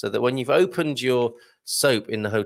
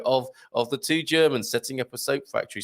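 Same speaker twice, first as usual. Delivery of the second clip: ordinary delivery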